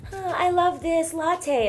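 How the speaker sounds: slow, easy voiceover line; slow audition read. Retold or imitated. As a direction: draw it out slowly like a commercial. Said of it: "I'd be like".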